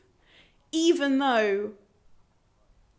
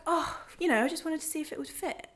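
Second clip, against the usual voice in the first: higher, breezy, offhand mock of the guy; mocking his casual tone; 7 semitones higher, 4 dB quieter than usual. She mimics the man shrugging off his attempt, higher and offhand. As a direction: lift the pitch and toss the excuse off like it's nothing. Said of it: "And he just is so, like".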